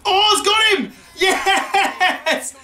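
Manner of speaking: high, laughing cheer; laughing joy. Real, not acted. Gamer celebrates his first career wicket, laughing. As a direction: burst out with relief and delight.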